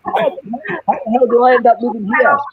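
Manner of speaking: high, loud cry of disbelief; incredulous amazement. Acted. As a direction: marvel at full pitch at where you've landed. Amazed at his own changed life.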